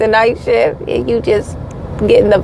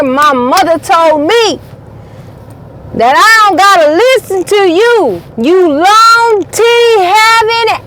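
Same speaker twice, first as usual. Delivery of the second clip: higher, louder, mocking taunt; a taunt that rises higher and louder; sassy taunt; 6 semitones higher, 4 dB louder than usual. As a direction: throw it at them like a playground insult. Mock insult, rising and louder.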